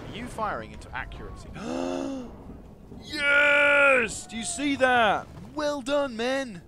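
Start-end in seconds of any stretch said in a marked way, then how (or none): 1.5-3.0 excited gasp. Real, not acted. 3.0-6.6 loud, high cheer of triumph; excited shout. Real, not acted. Gamer cheering his archers' hit, loud and high.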